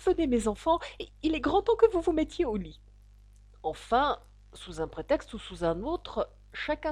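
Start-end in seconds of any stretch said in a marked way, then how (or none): 0.0-2.8 high, anxious mother bird; trembling voice. A narrator's reading. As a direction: call the little ones away nervously, pitched high. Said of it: "d'une voix tremblante". (voix aiguë et tremblante)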